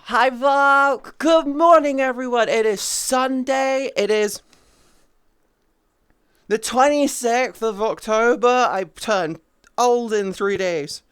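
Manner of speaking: high, loud announcing voice; bright greeting. Real, not acted. Streamer greeting everyone and announcing the date, high and loud. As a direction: announce the day to the whole room.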